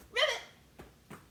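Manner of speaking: very high, very loud ribbit shout; loud frog ribbit. Shown as a demonstration. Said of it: "say ribbit really loud".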